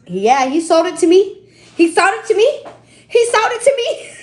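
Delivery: triumphant sing-song repeat; gleeful gloating. Real, not acted. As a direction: crow about the win, climbing up. Gloating he got the deal.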